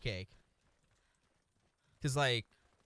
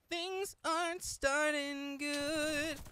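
silly voice; 11 semitones higher, much slower than usual. Pretend. Slow put-on high voice.

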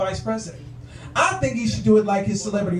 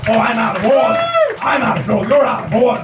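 louder, tense courtroom shout; tense Al Pacino shout; 5 dB louder than usual. Retold or imitated. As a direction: yell it like a furious lawyer. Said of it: "Al Pacino's really tense".

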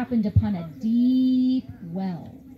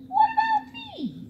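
high, slow pleading cry; goat crying out. Acted. Goat cries up to the departing fox, high and pleading. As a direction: plead up from the bottom of the well. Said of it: "cried the goat".

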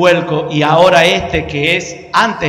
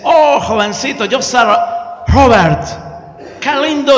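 upbeat gringo voice, a bit higher; American pastor voice; 5 semitones higher, 3 dB louder, slower than usual. Retold or imitated. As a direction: greet him like a cheerful gringo. Gringo greeting the driver. (voz de gringo alegre)

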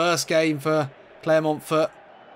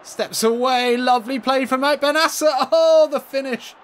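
rising higher and louder into the finish; building excitement; 10 semitones higher, 6 dB louder than usual. Real, not acted. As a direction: build the call toward the shot. Goal chance builds.

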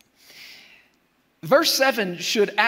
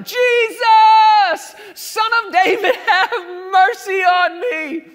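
even higher, louder desperate shout; crying louder; 11 semitones higher, 11 dB louder, slower than usual. Retold or imitated. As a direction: yell over everyone trying to hush you, much higher and louder. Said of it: "He cried the louder".

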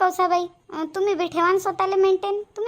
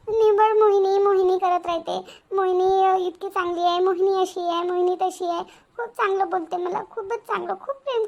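higher, strained voice faking that all is well; forced cheer; 4 semitones higher than usual. Acted. Daughter pretending to her mother that her mother-in-law is kind, strained. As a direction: cover the hurt with praise.

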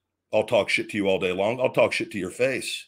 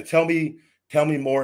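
much higher, pushy "tell me"; mocking taunt; 7 semitones higher, 3 dB louder than usual. Real, not acted. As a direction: bait him into talking. Taunting Chase.

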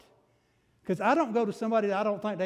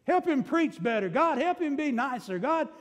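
higher fervent pleading, full of energy; fervent pleading prayer; 7 semitones higher than usual. Retold or imitated. Preacher acting out an earnest prayer for Tom, fervent and higher. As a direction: cry out to God for the pastor. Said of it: "put Tom in there big time".

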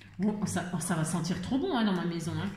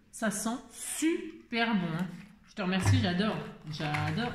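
normal talking voice

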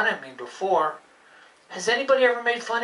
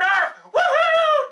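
excited bark and whoop; 4 dB louder, much slower than usual. Acted. Celebrating learning a word.

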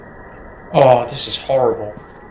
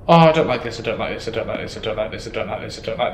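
softer frightened repeating, lower; scared muttering; 5 semitones lower, 4 dB quieter, faster than usual. Real, not acted. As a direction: murmur it over and over in fear. Scared muttering in horror game.

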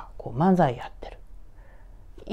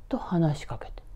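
ordinary delivery, nothing marked

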